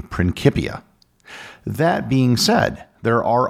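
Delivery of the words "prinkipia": "Principia" with a hard C, prin-KIP-ia; preferred Latin pronunciation. Shown as a demonstration. Host cites science historians, "Principia" with a hard K sound. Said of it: "their preferred pronunciation would be".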